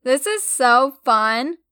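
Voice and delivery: slow, loud and delighted; enthused. Acted. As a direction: drag it out with glee. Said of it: "she enthused".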